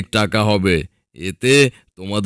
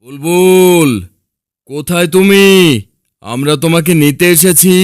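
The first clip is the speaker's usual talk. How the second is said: calling out; 8 semitones higher, 3 dB louder than usual. Acted. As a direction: holler for someone lost far away. হারানো বুলবুলকে ডেকে খোঁজা. (দূরে হারানো কাউকে টেনে টেনে ডাকা)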